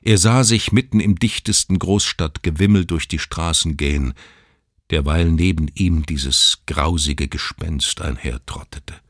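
ordinary delivery, nothing marked

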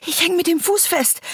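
high, loud breathless panic; panicked gasping. Acted. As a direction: gasp it out in terror. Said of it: "keuchte sie".

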